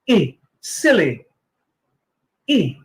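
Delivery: softly modelled short i in "Silly"; short i drill. Shown as a demonstration. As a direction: model the short i sound, quietly and clearly. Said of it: "Short I as in E".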